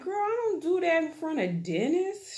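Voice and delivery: high, prim protest; prissy mock protest. Woman insisting to sister, high prim mock protest. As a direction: clutch your pearls and deny it.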